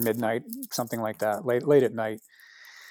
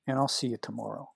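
tender blessing; 9 dB quieter than usual. Retold or imitated. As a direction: bless him quietly, a hint of the friend's tenderness. Blessing with hands on shoulders.

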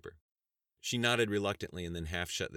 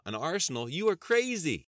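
loud, high-pitched disbelief; stunned; 11 semitones higher, 8 dB louder than usual. A narrator's reading. Stunned debater reacting to congressman's arsenal, crying out. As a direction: cry out in shock, climbing higher. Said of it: "Halluci was stunned".

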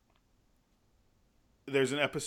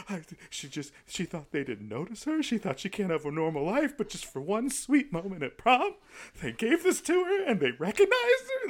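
high, squeaky near-crying retelling with laughter; worked up, tearful; 9 semitones higher than usual. Retold or imitated. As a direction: get worked up and climb higher, half laughing, half weeping. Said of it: "getting just a little bit worked up".

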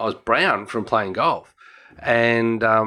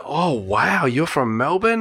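amazed locals; 4 semitones higher, slower than usual. Retold or imitated. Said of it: "they're like".

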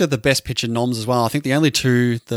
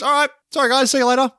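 much higher, airy and carefree; breezy Farvel voice; 11 semitones higher, faster than usual. Retold or imitated. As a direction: toss it off like a cheeky chancer. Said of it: "Farvel just goes".

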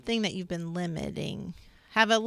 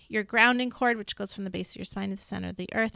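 talking really fast; faster than usual. Real, not acted. Host explaining a grounding cord to listeners, talking fast. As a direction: hurry through the details without emphasis. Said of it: "I'm talking really fast".

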